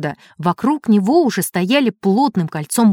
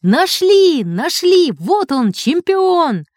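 crowd shouting; 7 semitones higher, much slower than usual. A narrator's reading. Fans carrying the boy overhead, cheering. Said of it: "и кричали".

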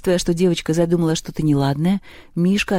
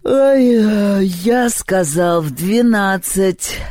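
slow, drawn-out irritated complaint; displeased grumble; 3 semitones higher, 4 dB louder, much slower than usual. A narrator's reading. Перун yawning at an early caller, displeased. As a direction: grumble through a yawn, stretching the words. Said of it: "недовольно сообщил он".

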